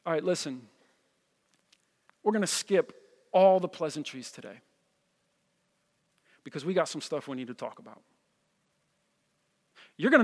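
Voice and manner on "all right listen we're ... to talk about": low, hushed and deliberate, with long pauses; abrupt serious opening. Pretend. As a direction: skip the warmth, sound gravely concerned. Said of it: "say I started the message like this".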